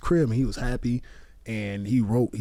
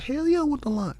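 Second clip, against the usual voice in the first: much higher, eager mock excitement; eager retold yes; 13 semitones higher than usual. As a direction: jump at the offer, pitch shooting up. Retold eager answer to director.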